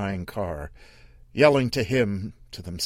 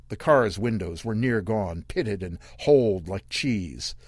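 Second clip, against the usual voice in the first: plain reading voice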